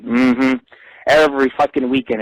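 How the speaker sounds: ordinary delivery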